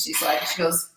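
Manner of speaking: ordinary talk, nothing marked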